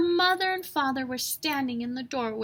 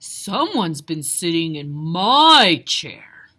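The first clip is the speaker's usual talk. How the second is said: low, loud, slow papa bear growl; deep booming father bear; Papa Bear grumbling; 6 semitones lower, 12 dB louder, slower than usual. A narrator's reading. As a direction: grumble it like a big gruff bear. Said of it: "grumbled Papa Bear".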